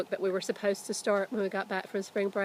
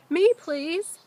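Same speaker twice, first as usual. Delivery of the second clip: put-on high, loud eager boy's reply; 9 semitones higher, 9 dB louder than usual. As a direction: jump at the offer like a kid. Jack answers dad eagerly.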